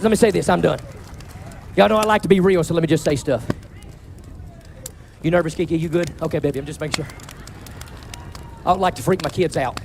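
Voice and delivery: ordinary delivery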